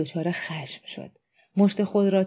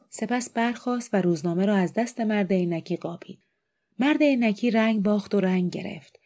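ordinary delivery, nothing marked

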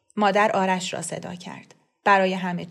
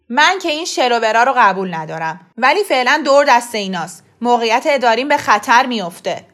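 louder, tense father explaining; loud defensive father; 3 semitones higher, 9 dB louder than usual. A narrator's reading. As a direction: defend himself to his wife.

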